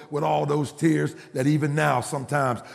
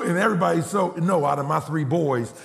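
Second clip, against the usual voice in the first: usual speaking voice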